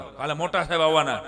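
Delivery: ominous teacher voice; scaring the kids. Retold or imitated. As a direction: warn the class with a threat. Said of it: "બીક બતાવતા".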